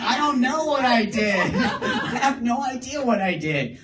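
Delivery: high and laughing, no clue; laughing denial. Retold or imitated. Driver telling friends he has no idea, laughing. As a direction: laugh out the confusion.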